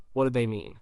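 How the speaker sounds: normal talking voice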